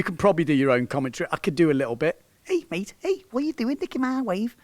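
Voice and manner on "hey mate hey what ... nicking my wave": put-on half-hearted Scouse accent, pitched up; a weak try at Liverpool Scouse; bad Scouse accent. Coach voicing the angry Scouser at the kite surfer, bad Scouse accent. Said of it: "I'm so bad at the Scouse accent".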